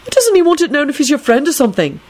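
high, incredulous questioning; demanding. A narrator's reading. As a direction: press for an answer in a high voice. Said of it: "Neville demanded".

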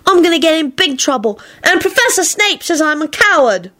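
put-on high, aggrieved child voice; sulky complaint. A narrator's reading. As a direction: complain like a sulking boy. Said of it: "Teddy sulked".

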